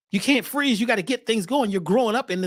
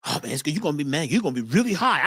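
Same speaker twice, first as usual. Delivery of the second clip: lightly voiced laid-back friend; low friend voice; 7 semitones lower, faster than usual. Friends offering weed, retold.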